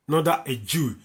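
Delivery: ordinary delivery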